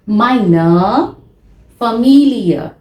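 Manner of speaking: drawn-out Meine Familie; repeat-after-me German model; teacher model pronunciation. Shown as a demonstration. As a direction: say Meine with a rising tune, Familie falling. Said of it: "Please repeat after me".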